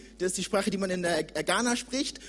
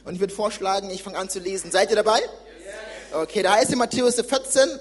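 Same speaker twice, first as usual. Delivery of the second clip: usual speaking voice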